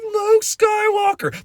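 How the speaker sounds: thrilled squeal. Retold or imitated. Star Wars fan telling viewers about the reveal, squealing with delight. Retelling the Luke Skywalker reveal.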